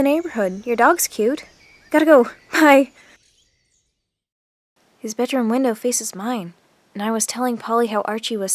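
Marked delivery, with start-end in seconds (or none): 1.9-2.9 louder, higher, flustered exit with a nervous laugh; flustered rush. Acted. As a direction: blurt it out and bolt, laughing nervously. Flustered leaving cute new neighbor.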